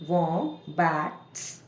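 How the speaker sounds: slow "वॉम्बैट्स" with the last s set apart as a hiss; final sound separated. Shown as a demonstration. Teacher shows the s separately, slow and broken up. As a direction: say "वॉम्बैट्स" as वॉम, बैट, sss. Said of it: "अलग".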